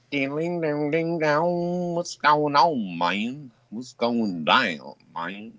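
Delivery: very slow, stretched-out Texan drawl; mock Southern twang. Shown as a demonstration. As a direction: drag every word like a hammed-up slack-jawed Southerner. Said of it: "I can speak regular English".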